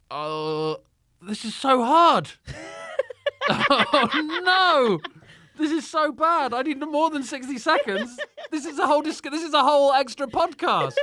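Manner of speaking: loud, high-pitched comic despair through laughter; exasperated panic. Real, not acted. Guest put on the spot over a dream match, laughing exasperation. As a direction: wail in helpless, laughing protest. Panicking at a rapid-fire question.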